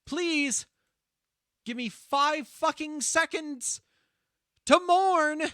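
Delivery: half-joking angry plea to a friend; mock fury. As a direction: snap at a friend in playful outrage.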